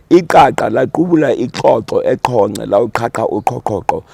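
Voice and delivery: Iqaqa laqubula ixoxo with full Xhosa clicks; Xhosa click sentence. Shown as a demonstration. Showing Southern relatives the Xhosa sentence, every click sounded. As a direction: rattle off the tongue twister clicking each q. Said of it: "How did it sound?".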